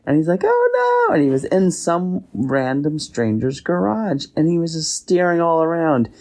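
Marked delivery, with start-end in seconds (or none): 0.4-1.1 high, louder squeal of alarm. Acted. Raccoon after breaking a skylight, high alarmed yelp. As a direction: yelp it in sudden panic.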